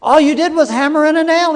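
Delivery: shocked outrage. Retold or imitated. Lady balks at the carpenter's bill, high and loud. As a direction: balk at the price, up high and loud. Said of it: "she looked at the bill and she said".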